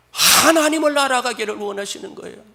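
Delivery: high, loud proclamation; full-force preaching. Real, not acted. Preacher to congregation, high and loud. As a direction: declare it with fire.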